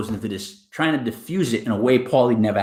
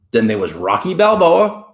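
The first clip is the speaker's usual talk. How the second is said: usual speaking voice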